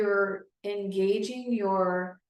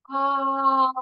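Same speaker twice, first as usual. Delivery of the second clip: pitch glide down; 5 semitones higher, 7 dB louder than usual. Shown as a demonstration. Therapist glides down again, loud falling ah. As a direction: glide down to the bottom note. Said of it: "you're gonna glide down again".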